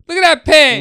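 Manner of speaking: loud, high cooing exclamation; mock gushing. Admiring a giant pig, mock gushing. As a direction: gush over it like a cute pet.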